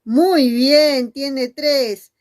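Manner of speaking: ordinary talk, nothing marked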